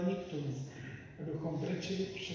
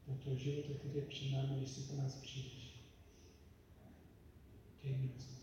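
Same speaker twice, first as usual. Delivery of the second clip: quiet prayer; 5 dB quieter than usual. Acted. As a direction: pray softly and earnestly. Leads a long prayer. (tichá modlitba)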